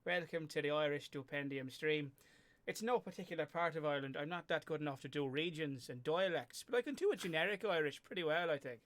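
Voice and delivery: generic Irish welcome; cheery stream host in stage Irish; Irish accent. Shown as a demonstration. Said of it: "asking me to do an Irish accent".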